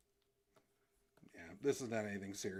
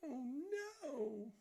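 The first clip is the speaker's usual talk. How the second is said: cartoon panic; 3 dB louder than usual. Voicing cartoon figure while drawing.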